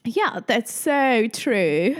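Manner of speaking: put-on speaking English in a posh British accent. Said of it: "richtig guten britischen Akzent".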